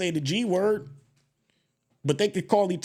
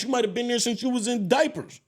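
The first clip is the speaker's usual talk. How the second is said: ordinary talk, nothing marked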